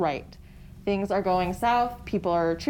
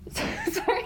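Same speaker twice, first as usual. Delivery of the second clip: high-pitched giggle through the word; laughing apology. Real, not acted. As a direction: apologise while cracking up. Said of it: "I can't even say it".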